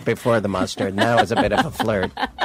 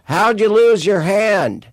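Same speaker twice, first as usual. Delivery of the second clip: high, slow heckler shout; drunk heckler; 9 semitones higher, 5 dB louder, slower than usual. Retold or imitated. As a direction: call out from the crowd like a drunk. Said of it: "someone said".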